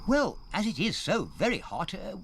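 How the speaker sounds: lumbering friendly pit elephant from the north; northern elephant. Acted.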